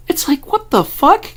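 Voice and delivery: real astonishment bursting out; shocked high what the fuck; stunned. Real, not acted. Shock at the prescient message.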